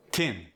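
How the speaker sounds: "Tin" with a hard T for TH; Cajun tin. Shown as a demonstration. Showing learners TH becomes T, "Tin". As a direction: say "Tin" with a plain T. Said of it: "gets the T instead".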